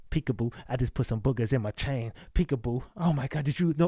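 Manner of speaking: mock breathless fan. Mocking a fan swooning over the Peekaboo lyric, low and soft.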